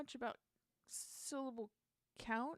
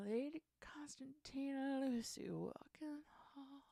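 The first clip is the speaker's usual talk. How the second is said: breathy whisper of the line; whispered lyric try; 6 semitones higher than usual. Real, not acted. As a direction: whisper it to test the rhythm. Whispering draft lyric lines.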